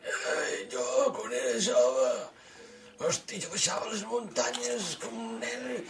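put-on high, slow old-timer sighing; a hint of a doddering grandpa reminiscing; lightly voiced old man. Mocked as old, he plays a wistful old man.

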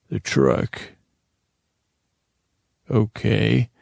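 ordinary talk

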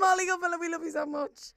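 high, loud, gushing lament; apologetic wail. Real, not acted. Host to forgotten guest, wailing apology. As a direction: wail your love by way of apology.

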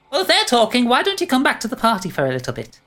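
sing-song fairy voice; high pixie voice. Acted.

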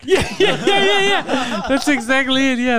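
high laughing agreement; laughing yes. Real, not acted. Host laughing along with his friend's dog joke, agreeing. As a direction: laugh through the yeahs.

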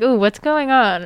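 puzzled, curious outburst, played up; acted puzzlement. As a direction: act out a baffled reaction to a strange dream. Acting out puzzled dream reaction.

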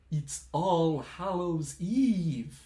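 dragged out slowly; slow dramatic announcement. Acted.

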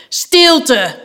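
high-pitched commanding yell; bellowing. A narrator's reading. Knight silencing a protesting boy, bellowed command. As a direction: bellow for order. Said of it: "bulderde de ridster".

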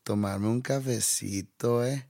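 put-on slow drawn-out Colombian accent; slow Colombian imitation. Mocking the friend's Colombian take, slow Colombian accent. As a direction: draw the words out slowly in Colombian. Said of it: "si hablan así muy lento".

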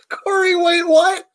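sudden high shocked cry of disbelief; double take. Real, not acted. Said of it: "I wasn't ready for that news".

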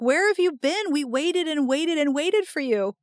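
high, plaintive whine of waiting friends; playfully exasperated friends; mock complaint. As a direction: pile up the reproach in a high voice.

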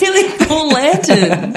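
high, slow, laughing gush; drawn-out delighted praise. Real, not acted. Gushing to a friend about the lanterns, high and laughing.

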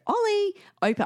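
put-on high-pitched call to a child. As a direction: call through a locked door. Calling to locked-in child.

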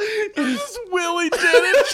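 words through laughter; laughing. Real, not acted. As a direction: laugh while talking.

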